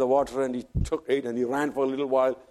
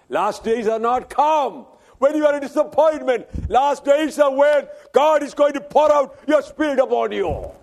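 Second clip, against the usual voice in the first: raised, ringing preacher's voice; preaching at full force; 14 semitones higher, 8 dB louder than usual. Acted. Preacher retelling Elijah's flight to the congregation, preaching at full force. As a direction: proclaim it to the congregation. Shouted climax of sermon.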